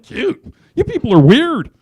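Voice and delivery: high-pitched disbelieving retort, getting loud; incredulous. Retold or imitated. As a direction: reenact appalled disbelief at other owners. Incredulous retort to gushing owners.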